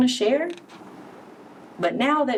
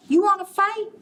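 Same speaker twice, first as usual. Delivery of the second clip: grouchy ladybug; 9 semitones higher than usual. Acted. Ladybug calling out to the elephant, high and cocky. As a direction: shout up at the big beast. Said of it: "said the grouchy ladybug".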